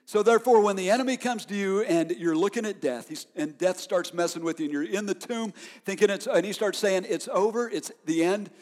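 preacher climbing toward a shout; preaching. Acted. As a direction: build the fire of the sermon. Preacher building intensity.

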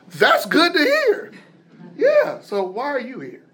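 slow, high, uneasy laughing question; forced chuckle, then a wary ask; nervous laugh. Retold or imitated. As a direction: laugh it off while fishing for answers. Nervous reply to smiling doctor.

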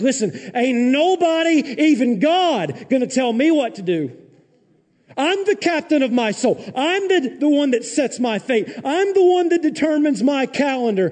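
be the stubborn churchgoer who won't be told; defiant rant. Pretend. Pastor imitating a defiant churchgoer, loud and high. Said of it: "people will be like".

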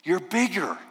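lightly voiced young girl, wondering; a child marvelling up at the lion; Lucy. Lucy amazed, speaking to Aslan in a light girlish voice.

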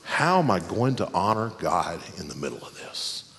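low, soft, reflective question; quietly devout wondering; calm focus. Acted. Calm focus set against panic.

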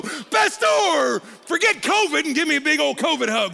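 high, loud gushing voice; over-eager churchgoer. Retold or imitated. Preacher imitating a hug-seeking churchgoer, loud and gushing. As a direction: gush at the pastor like a hugger. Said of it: "would you go".

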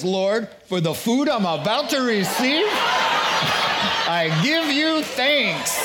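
a high, solemn mock grace breaking into a laugh; praying bear. Bear saying grace over the atheist, hammed-up solemn. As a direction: deliver the punchline as a pious bear. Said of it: "bows his head and says".

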